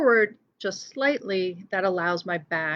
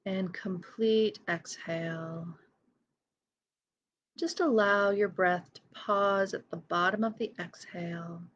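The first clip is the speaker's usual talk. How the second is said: guided meditation. Real, not acted. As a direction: lead each breath gently, leaving silence between phrases. Leads a breathing meditation.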